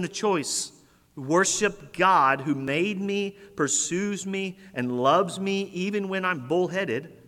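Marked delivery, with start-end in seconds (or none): none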